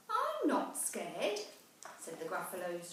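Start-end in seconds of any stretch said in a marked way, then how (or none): 0.1-1.4 high and slow, bravely insisting; a small monster talking herself brave; little Gruffalo child. Acted. Child braves the dark wood.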